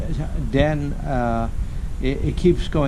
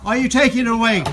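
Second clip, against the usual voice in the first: loud sharp voice; 10 semitones higher than usual. Real, not acted. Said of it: "Stop shouting at me".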